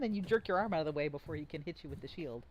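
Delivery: ordinary talk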